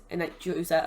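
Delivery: ordinary talk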